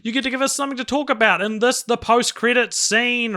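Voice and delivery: hammed-up radio announcer; announcer intro. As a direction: announce the segment like a host.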